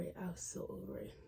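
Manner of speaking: fading into a whisper, fed up. Real, not acted. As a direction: whisper it, drained and done. Fed-up trailing aside.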